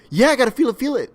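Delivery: high, loud mocking celebrity impression; Mark Wahlberg. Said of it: "my Mark Wahlberg impression".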